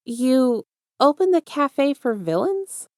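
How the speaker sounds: high, slow disbelieving question; incredulous interjection. A narrator's reading. Izuku cutting in on the villain's plan, high and incredulous. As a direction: cut in, unable to believe it. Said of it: "Izuku interjects".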